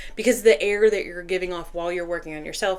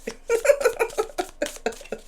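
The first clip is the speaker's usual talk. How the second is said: high, airy run of giggles; high rippling laugh; amused giggle. Real, not acted. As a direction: giggle in a long string of short pulses. Laughs after saying boat.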